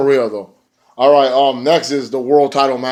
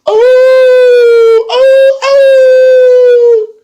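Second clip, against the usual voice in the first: very high, loud mock wail; hammed-up howling; wailing cry; 12 dB louder, much slower than usual. The Wolves entering, imitated with a very high wail. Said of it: "the Wolves come out".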